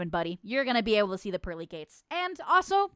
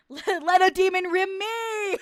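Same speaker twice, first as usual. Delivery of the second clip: laughing; 7 semitones higher, 5 dB louder, slower than usual. Retold or imitated. Laughing imitation of chat.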